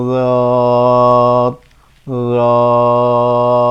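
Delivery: রদ said low and heavy, tongue back on the gums; letter sound demo. Shown as a demonstration. Teacher demonstrating রদ to learners twice. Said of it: "এটা আসলে উচ্চারণটা হবে".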